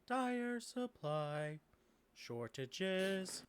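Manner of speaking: slow, monotone robot greeting; courteous automaton, word by word; polite robot quest-giver. Acted. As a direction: speak in held, stepped machine tones. Robot quest-giver Lawrence answers.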